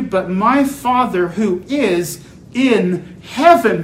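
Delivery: loud, high, ringing sermon delivery; full-force preaching. Real, not acted. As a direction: proclaim the text forcefully, punching key words.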